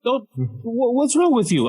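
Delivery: high-pitched flustered indignation; indignant protest. Retold or imitated. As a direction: act out an embarrassed patient objecting. Embarrassed patient protesting to nurses.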